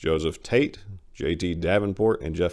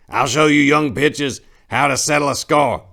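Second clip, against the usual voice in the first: put-on high, loud threatening old man; mean old man; 8 semitones higher, 8 dB louder than usual. Old Apricot threatening the young men, loud high bluster. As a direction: threaten them like a cane-waving coot.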